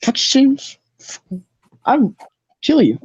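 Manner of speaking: high-pitched mock threat; Enoch. Enoch threatening the ticketmaster, high mock-angry voice. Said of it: "And Enoch's like".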